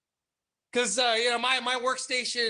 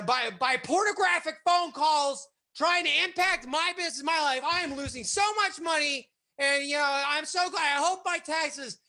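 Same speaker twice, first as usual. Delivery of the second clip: mock furious rant; 7 semitones higher than usual. Boss ranting to the cops about his losses, indignant.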